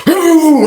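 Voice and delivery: high, loud mock crowd "ooh"; hammed-up onlooker ooh. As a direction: imitate the crowd gasping ooh. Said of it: "that's why you hear noises like that".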